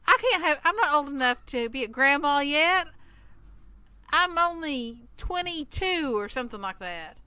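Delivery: usual speaking voice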